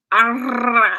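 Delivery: a loud "Arr" trying to trill the r; rolled R attempt. Shown as a demonstration. Announcing a try at rolling her R's, loud attempt. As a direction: try hard to roll the R in "Arr". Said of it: "listen, are you ready?".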